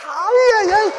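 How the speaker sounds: squeaky mock exclamation, high; a silly high-pitched yelp of delight; playful high wail.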